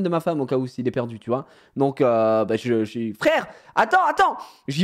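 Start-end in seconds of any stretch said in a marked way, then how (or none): 3.2-4.4 high coaxing call; calling after cat. Retold or imitated.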